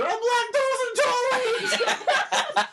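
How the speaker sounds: high, slow, laughing mock whine; mock high wail. Joking the mom got a pie, high slow laughing whine. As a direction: wail it like a silly victim.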